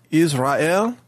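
Israel in three syllables, IZ-RA-EL, slow; careful Hebrew-like Israel with a clear final EL; closer to Hebrew. Shown as a demonstration. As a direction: spell out Israel as iz-ra-EL, every vowel sounded. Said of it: "the way it would be pronounced".

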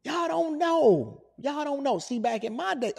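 put-on slow, high old codger; old man voice. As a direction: grumble like a cranky elder. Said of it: "all right, Unc".

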